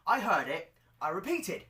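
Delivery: ordinary delivery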